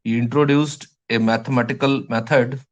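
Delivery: each word drawn out slowly for writing; slow dictation. Shown as a demonstration. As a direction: dictate slowly so students can copy. (धीरे-धीरे लिखवाते हुए)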